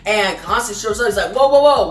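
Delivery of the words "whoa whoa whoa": louder, higher startled whoa. Retold or imitated. Constantine arriving on gunfire, startled and louder. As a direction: pull up short, startled by the shooting.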